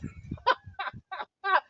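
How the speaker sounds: high burst of laughter; real amused laugh. Real, not acted. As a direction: laugh after your own impression. Laughs after his own impression.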